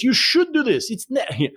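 high-pitched mock teacher translating; French teacher's voice. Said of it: "and he goes".

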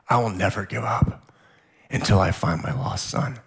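low, heavy-hearted resolve; emotional vow. Real, not acted. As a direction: vow it while holding back real emotion. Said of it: "still emotional now".

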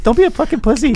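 mock high-pitched self-pep talk; squeaky out-loud version. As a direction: say it like the tough line comes out weak and squeaky. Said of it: "when you say it out loud, you're like".

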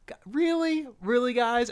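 exasperated viewer calling out the writers; mock disbelief. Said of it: "It just felt really just kind of like".

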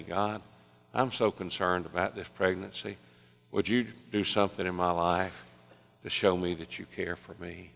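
soft, subdued plea; retold quiet prayer. Waitress concerned about this pregnancy, retold quiet prayer.

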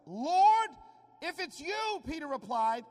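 high, loud call across the water. Acted. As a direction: shout from the boat, slow and pleading. Peter calls out to Jesus.

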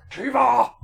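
high, loud angry yell; shouting. Retold or imitated. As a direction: scream the name across the field. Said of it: "in the back going".

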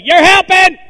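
high, loud holler from the pews; hollering man. Retold or imitated. Preacher imitating a congregant hollering, high and loud. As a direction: yell it out like a man in the crowd. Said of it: "ain't heard nobody say".

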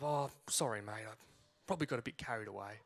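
low, soft, slightly mumbled sorry, imitated; mock sheepish apology. Marshal apologising after his wife pushed him, mock sheepish.

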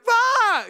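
sudden loud high "Bah!"; mock scare shout. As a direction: leap out and shout it. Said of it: "they're like".

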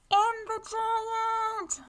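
high, slow call from inside; girl answering. A narrator's reading. Girl calling back to her mother from inside. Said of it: "And Tutu Moo answered".

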